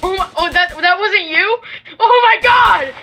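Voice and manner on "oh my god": excited shout. Real, not acted. Shock in the game.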